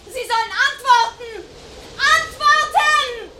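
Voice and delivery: yelling in panic at a stranger; frightened shout. Acted. Woman alone yells at unseen intruder, panicked shout. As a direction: demand an answer at the top of her voice.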